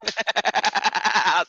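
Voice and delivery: long, rattling laugh at the opponent; derisive laughter. Real, not acted. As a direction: laugh openly at the other debater. Laughs amid the argument.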